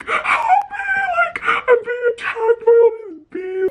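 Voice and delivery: high, wailing plea for help; crying for help. Retold or imitated. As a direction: wail it to the parents. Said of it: "like, crying".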